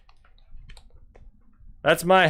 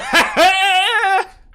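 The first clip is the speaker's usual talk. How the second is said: high, loud cackling laugh; loud mocking laugh; 10 dB louder than usual. Real, not acted. Reactor cracking up at his own heckle, loud high laugh. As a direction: cackle at your own burn.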